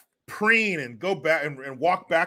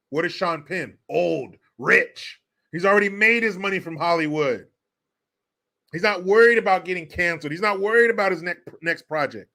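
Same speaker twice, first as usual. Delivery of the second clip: ordinary delivery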